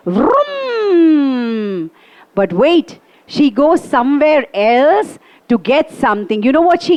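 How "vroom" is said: auto starting off. Retold or imitated. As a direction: imitate a rickshaw pulling off. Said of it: "sits in the auto and goes".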